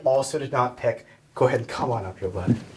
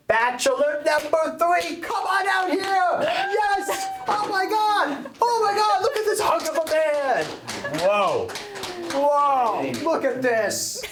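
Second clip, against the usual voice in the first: excited shouting; 12 semitones higher, slower than usual. Acted. Host calls out winning bachelor.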